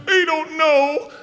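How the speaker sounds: put-on high, drawn-out little-kid wail; panicked little boy. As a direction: wail it slowly like a panicked child. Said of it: "he's still panicked".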